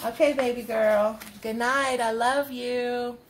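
ordinary delivery

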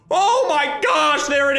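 slow, amazed gasp of joy; excited. Real, not acted. Streamer seeing the rare pet appear, amazed. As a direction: let the wonder spill out. Trade screen shows huge pet.

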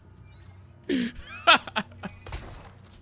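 high, breathy burst of laughter. Real, not acted. Player laughing at the villain's silly delivery, amused. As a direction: crack up helplessly. Laughing at his own impression.

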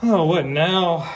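ordinary delivery, nothing marked

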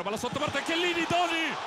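high shout on the save; excited commentary. Real, not acted. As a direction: yell the names as the keeper saves. Save by keeper on chance.

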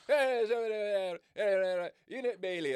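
loud, high, insistent calling; barber calling out. Retold or imitated. Barber calling him out in patois, loud and high. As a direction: hail him across the shop, loud and high. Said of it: "man will be chatting like".

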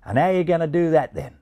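put-on Australian accent. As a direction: ask it like a curious Aussie. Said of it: "said the Australian pigeon".

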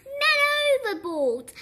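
loud, high alarm call falling on the second word; storybook sailor raising the alarm; sailor's cry. A narrator's reading. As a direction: cry out the alarm to the whole ship. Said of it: "the sailor cried".

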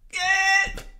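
very high panicked bawl; bawling for help. A narrator's reading. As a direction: bawl out for rescue. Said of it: "vrælede Orla".

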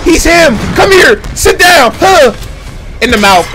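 short, high, loud commands; hyped fight shouts. Acted. Watcher playing along as the fighter, shouting. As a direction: shout each move as if landing it.

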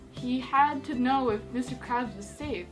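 usual speaking voice

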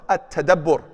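At-tadabbur with a doubled b and a rolled r; Arabic pronunciation. Shown as a demonstration. Teacher naming the third stage, careful Arabic pronunciation. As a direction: sound out At-tadabbur syllable by syllable. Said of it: "this is called what?".

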